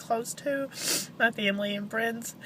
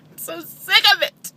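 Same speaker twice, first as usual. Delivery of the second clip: crying out in despair; snotty crying; 8 semitones higher, 8 dB louder than usual. Real, not acted. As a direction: voice breaking into sobs. Grieving mother breaks down.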